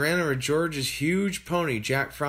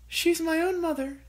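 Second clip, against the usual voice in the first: higher voiced mother, pleading; mum's voice. A narrator's reading. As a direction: stand up for her own mother. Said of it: "she said".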